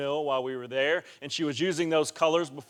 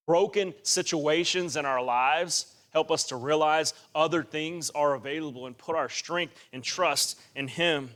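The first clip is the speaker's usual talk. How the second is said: ordinary talk, nothing marked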